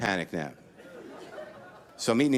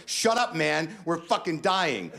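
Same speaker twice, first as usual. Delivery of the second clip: higher, slow, hammed-up despair; panicked snap; 9 semitones higher, slower than usual. Comic refusing his inner voice's advice, mock panic. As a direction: wave off the voice of reason. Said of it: "I'm like".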